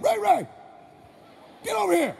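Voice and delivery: high, loud yell across the yard; calling yell. Retold or imitated. Neighbor dad hollering for his son, a high loud yell. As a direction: holler for the boy to come out. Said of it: "That's how he yelled".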